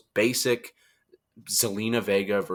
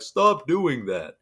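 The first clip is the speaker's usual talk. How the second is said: slow, higher-pitched telling-off; mock scolding; 4 semitones higher, much slower than usual. Referee tells off Rhea at the cage, mock scolding. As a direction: draw it out as a feeble order.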